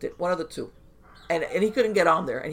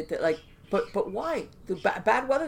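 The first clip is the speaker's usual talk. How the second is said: ordinary talk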